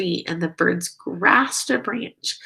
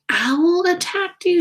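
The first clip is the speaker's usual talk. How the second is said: alarmed; 10 semitones higher, 5 dB louder than usual. A narrator's reading. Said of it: "she asked".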